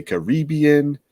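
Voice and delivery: Caribbean with a long REE, stressed second syllable; Caribbean as kuh-REE-bee-un. Shown as a demonstration. Host lists a third odd option, Caribbean stressed on REE. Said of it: "emphasis or something".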